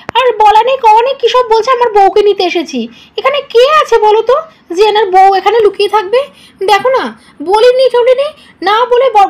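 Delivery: panicked shouts through the house; calling out in alarm. Acted. Frightened girl calling family for help.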